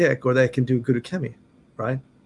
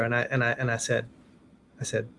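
ordinary delivery

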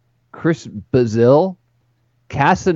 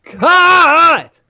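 shouting cut; 6 dB louder than usual. Pretend. Mock announcing a player cut.

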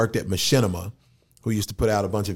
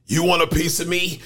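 higher-pitched cartoon robot challenge; Bumblebee; 7 semitones higher than usual. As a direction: throw it out as a high, cocky taunt. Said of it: "Bumblebee doesn't really have a voice".

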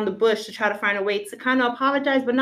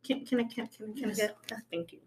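hushed thanks off to the side; whispered aside; 14 dB quieter than usual. Real, not acted. Quiet aside off mic.

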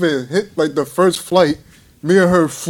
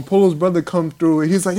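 ordinary talk, nothing marked

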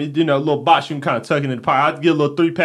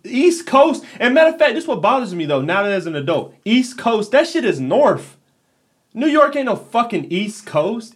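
higher, heated ranting; worked-up rant; 7 semitones higher than usual. Real, not acted. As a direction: rant it up in disbelief. Heated rant about geography.

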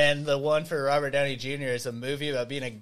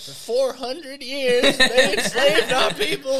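slow, much higher and louder mock oratory, laughing; hammed-up speech; 9 semitones higher, 6 dB louder, slower than usual. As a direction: declaim it like an overacted film rant. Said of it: "he's like".

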